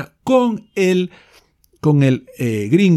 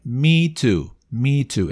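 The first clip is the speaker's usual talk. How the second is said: ordinary talk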